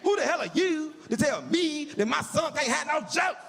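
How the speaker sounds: high, hammed-up indignation; storming in with mock outrage; mock indignant. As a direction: barge in playing the outraged dad. Confronting the mother angrily.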